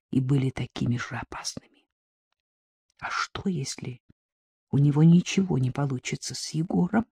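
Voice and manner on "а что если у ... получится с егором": hushed, apprehensive inner voice; soft worried musing; wary thought. A narrator's reading. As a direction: think it warily, starting almost in a whisper. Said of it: "Мелькнула в голове опасливая мысль".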